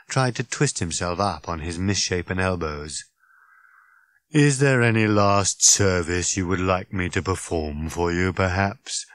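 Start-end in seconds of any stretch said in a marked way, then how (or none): 4.3-9.0 dreary, hollow robot voice; glum, monotone servile robot; hollow rattle. Acted. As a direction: offer service with weary martyrdom. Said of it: "in a kind of hollow rattle".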